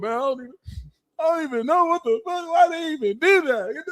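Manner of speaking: high, fast whiny complaint; sulking fire sign. Retold or imitated. Fire sign privately upset, high and whiny. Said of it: "behind closed doors".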